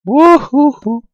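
very high, slow falsetto whoop; gleeful whoop. Real, not acted. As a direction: let out a drawn-out squeaky cheer. Grito de alegría agudo. (grito agudo y alegre, muy alargado)

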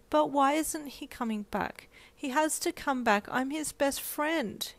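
put-on high, slow child's plea; insistent child. Four-year-old pleading with her mum, high and slow. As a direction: plead like a stubborn little girl. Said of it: "hoping that your strong words".

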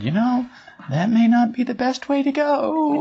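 high, tiny voice of conscience; little inner voice. Retold or imitated. Inner voice cautioning a woman after her argument, high and tiny. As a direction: pipe up high, as a timid inner voice. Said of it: "this little voice said".

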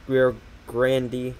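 GRANDE said as "grandy"; two-syllable GRANDE, like "gran-dee"; mispronounced GRANDE. Real, not acted. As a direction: say GRANDE to rhyme with "candy". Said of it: "forget how to pronounce Grande".

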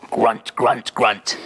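low piggy grunts; pig grunting. Acted. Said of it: "And a pig goes".

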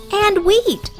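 ordinary delivery

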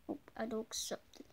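quiet whisper. Acted. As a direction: hush it dismissively. Whispered refusal.

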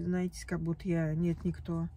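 ordinary talk, nothing marked

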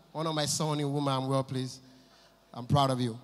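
lightly voiced boss, low and subdued; quietly patronizing, pitched well down; low, soft big-man voice; oga's praise. Voicing his oga giving patronizing praise.

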